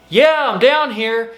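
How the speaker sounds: high, loud slow yell from the bottom of a pit; yelling up for help. Retold or imitated. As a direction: call up to the rescuer, stretching the words. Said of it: "And you yell".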